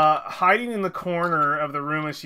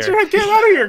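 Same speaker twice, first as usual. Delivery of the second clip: much higher, louder mock order; mock shout; 10 dB louder, much faster than usual. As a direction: shoo the theory away in jest. GM echoes the joke.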